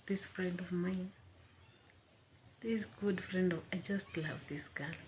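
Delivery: soft unvoiced whisper of affection; tender whisper. Real, not acted. About the friend she loves, a soft tender whisper. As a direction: whisper it with love.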